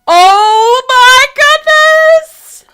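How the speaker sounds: very high, loud, drawn-out cry; shocked shriek. Pretend. Player reacting to Mitchell's killing, shocked shriek. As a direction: shriek in drawn-out, theatrical horror.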